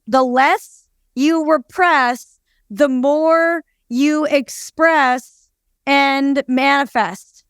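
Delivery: very slow, spaced out for note-taking; slow dictation. Real, not acted. Co-host restating her line to be written down, slow dictation. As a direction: say it slowly so it can be written down. Repeats it for writing down.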